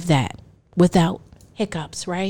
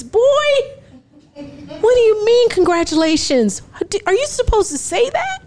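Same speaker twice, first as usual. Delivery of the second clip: high laughing disbelief; 10 semitones higher than usual. Retold or imitated. Mom balks at biological father's congratulations, high and laughing.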